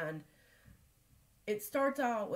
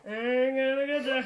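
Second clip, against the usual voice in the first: laughing threat; 4 semitones higher, 8 dB louder than usual. Real, not acted. Mother teasing her son again, laughing through it. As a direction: giggle through the words. Playful chase with the child.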